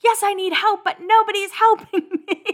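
high-pitched mock wail, breaking into a laugh. Singer complaining nobody helps her, mock-desperate wail. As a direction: whine it way up high, hammed-up helplessness. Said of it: "And she's like".

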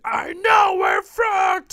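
drawn-out, high-pitched mock-angry yell; pissed-off shout. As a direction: act out an exasperated outburst at full volume. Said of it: "he's really pissed off".

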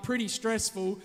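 normal talking voice

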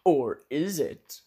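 slow, ominous cliffhanger question; dramatic narrator tease. Acted. Narrator teasing listeners with a slow ominous question. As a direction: draw it out like a trailer voice. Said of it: "and that's the last we saw of our heroes".

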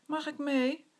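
small high pleading voice; little cat begging to tag along; eager little sister. Acted. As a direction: ask to come along like a little kitten. Little sister Witje asks along.

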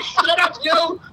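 high-pitched, exasperated hushing; mock scolding. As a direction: play a flustered dad silencing his kid. Retold embarrassed dad hushing his kid.

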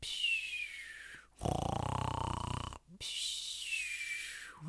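whispered sleepy snore sounds; snoring breaths. Acted. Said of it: "went the Fox".

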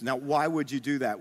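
ordinary talk

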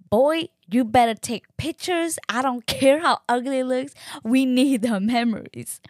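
loud, high, emphatic mock scolding; hammed-up insistent telling-off; bossy demand. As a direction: order him around with playful force. Acted bossy order to husband.

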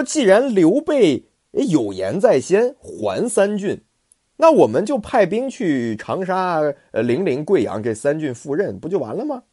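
稍高、放慢，君主的口吻; 像君主一样不紧不慢地吩咐; 孙权发令. Acted. 孙权下令取三郡. (略带威严的孙权，稍高放慢)

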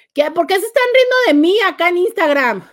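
loud, high-pitched playful protest; mock indignation. Host to viewers laughing at her on Instagram, mock-indignant. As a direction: protest as if wounded by the teasing.

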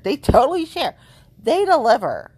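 high, loud, gushing; excited emphasis. Real, not acted. Vlogger hyping the brewery to viewers, excited and loud. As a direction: really sell TOTALLY. Repeats the plug, louder and higher.

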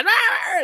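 high-pitched imitated shriek; mock rat scream. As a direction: shriek like two trapped rats. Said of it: "And they both screaming".

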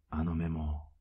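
ordinary talk

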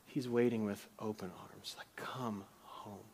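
whispered, welcoming like a waiting father; tender whisper. Retold or imitated. Pastor voicing the father calling his son home, whispered. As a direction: breathe it softly, arms open.

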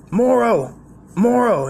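high, loud bark of the name; hollering across the office for him; boss yelling a name. Retold or imitated. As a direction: call him like a gruff old Marine boss. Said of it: "That's how he talked".

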